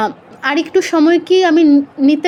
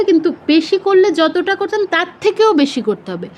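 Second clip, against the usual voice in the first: ordinary talk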